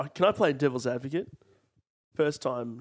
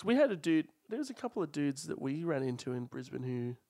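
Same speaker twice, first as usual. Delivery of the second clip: normal talking voice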